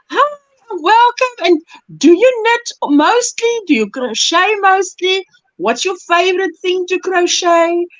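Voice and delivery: high, gushing greeting to a newcomer; eager welcome. Acted. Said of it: "when we meet somebody new, it's like".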